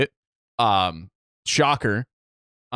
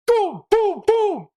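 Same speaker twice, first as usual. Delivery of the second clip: imitated booms; 4 dB louder, much slower than usual.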